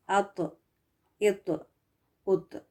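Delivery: ات with a bounced heavy t release; qalqala bounce. Shown as a demonstration. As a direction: bounce the final ط each time. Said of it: "قلقلہ کے ساتھ اس کی آواز کو bounce". (ات قلقلہ کے ساتھ، bounce کر کے)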